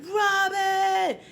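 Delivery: very high, loud, drawn-out holler; imitating a mother calling at full voice; mother's yell. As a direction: yell the child's name from inside the house. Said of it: "She'd be yelling my name".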